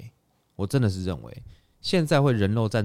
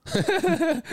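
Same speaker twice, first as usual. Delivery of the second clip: burst of laughter. Real, not acted. Laughs at own comeback.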